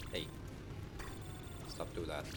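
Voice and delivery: ordinary delivery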